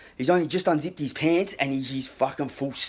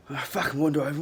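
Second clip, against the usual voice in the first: plain reading voice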